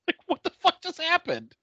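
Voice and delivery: ordinary delivery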